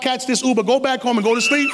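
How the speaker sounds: ordinary delivery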